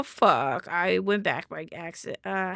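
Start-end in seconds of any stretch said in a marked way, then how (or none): none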